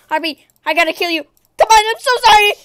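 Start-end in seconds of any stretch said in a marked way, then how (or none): 1.6-2.5 high, loud apology; loud, raised-pitch plea; pleading shout. Real, not acted. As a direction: cry it out in protest. Apologising while killing players.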